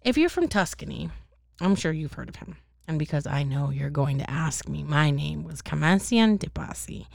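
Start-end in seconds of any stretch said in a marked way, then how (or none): none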